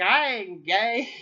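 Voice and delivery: slow, loud mock denial; defensive drunk guy. Imitating the drunk neighbor, slow loud denial. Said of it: "he's like".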